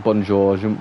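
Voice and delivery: French with no French accent; English-accented French. Shown as a demonstration. Streamer showing his basic French, in an English accent. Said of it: "that's in an English accent".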